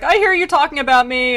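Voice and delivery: high, loud calling out; mock distant shout. Soul out on the plain calling to gossipers, mock yell. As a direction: holler it across a distance, played for laughs.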